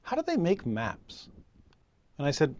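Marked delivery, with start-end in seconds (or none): 0.0-1.3 lightly voiced curious little girl, higher pitch; five-year-old daughter. Five-year-old calling to dad from the back seat, lightly imitated child voice. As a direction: pipe up like a small child, pitched up. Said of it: "my five-year-old daughter piped up".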